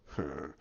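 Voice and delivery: very low, soft growl; low Hulk grunt. Acted. Hulk sees the Chitauri show up, low grunt. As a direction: give a short deep grunt.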